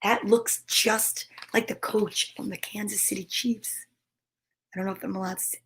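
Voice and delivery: hushed aside, no voice; whisper. Real, not acted. Reader whispers a joke aside to viewers. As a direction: whisper it like a secret to the camera.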